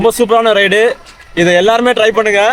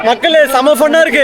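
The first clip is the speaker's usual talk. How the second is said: excited; 7 semitones higher than usual. Real, not acted. Talking to viewers mid ride.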